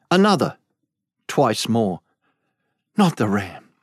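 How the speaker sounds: ordinary delivery, nothing marked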